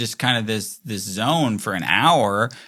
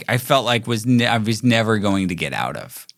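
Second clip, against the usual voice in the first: ordinary talk, nothing marked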